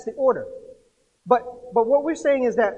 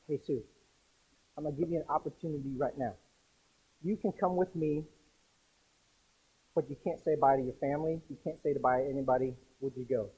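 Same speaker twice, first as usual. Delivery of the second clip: soft Jesus voice; 7 semitones lower, 8 dB quieter than usual. Retold or imitated. Jesus voiced offering to take her.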